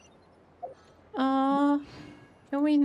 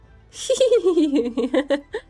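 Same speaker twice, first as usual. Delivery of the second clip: laughing; 4 semitones higher, 5 dB louder than usual. Real, not acted. Laughs at silly headwear.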